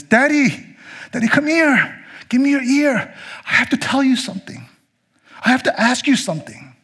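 put-on high eager child voice calling daddy; little child. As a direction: tug at daddy like a small child. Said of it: "like a little child that goes up to its daddy".

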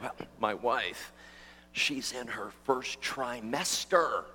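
low, soft, slow confession; sheepish husband. Retold or imitated. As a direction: play a bashful husband sharing news quietly. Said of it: "they got real sheepish".

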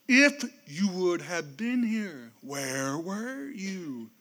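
slow, soft, broken lament; grieving reproach. Acted. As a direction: drag it out in hushed grief. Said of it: "and said".

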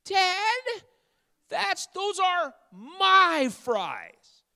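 hammed-up squealing daughter, very high and drawn out; mock outraged child's cry; high-pitched daughter. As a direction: cry out in a strained falsetto as the indignant girl. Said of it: "I can't do it in a high-pitched voice".

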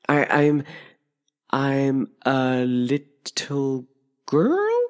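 slow, halting, questioning answer; hesitant girl doubting her own words; doubtful. A narrator's reading. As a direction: stretch it out, unsure, ending as a question. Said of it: "rather doubtf-, doubtfully".

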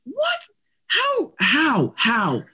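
mock-amazed "what, how, how" at a guide's uncanny call; stunned disbelief. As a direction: play it like you just saw actual magic. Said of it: "And I'm like".

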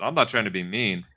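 ordinary talk

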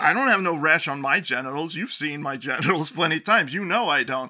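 imitated indignant denial; angry girlfriend. Girlfriend denying the rash to her boyfriend, angry. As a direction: play up her offended protest. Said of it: "to express the anger that she had".

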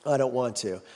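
low, softened mock refusal; lightly voiced reluctant listener; reluctant objection. Reluctant listener answering the preacher's challenge, mild low refusal. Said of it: "the first objection is".

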